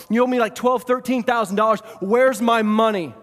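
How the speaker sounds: loud, high acted outburst; angry demand. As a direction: shout like a creditor grabbing a debtor by the throat. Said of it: "starts choking him and says".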